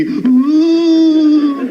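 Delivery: loud, high ghostly "ooh"; ghost wail. Acted. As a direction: wail like a spook, high and long. Imitates ghost at the park.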